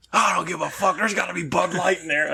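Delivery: lightly voiced brawler; Stone Cold. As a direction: brush it off like a wrestler. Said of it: "Stone Cold replies".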